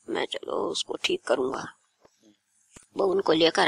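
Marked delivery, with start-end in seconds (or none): 0.1-1.6 low murmured elder voice; old holy man. A narrator's reading. Elderly holy man promises to fix it, low murmur. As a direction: murmur low like an aged saint.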